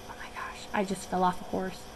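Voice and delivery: breathless shaken whisper; small girl whispering after a fall; stunned whisper. Retold or imitated. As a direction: whisper it as if in shock.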